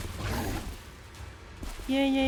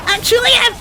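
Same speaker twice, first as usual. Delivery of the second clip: very high, loud squeak; squealed comeback; 12 semitones higher, 7 dB louder, faster than usual. Real, not acted. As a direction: squeak it out in mock defiance.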